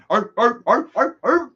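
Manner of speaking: quick rising barks; playful dog barks. Pretend. Calling out to all his dogs, playful barking. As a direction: bark like an excited dog.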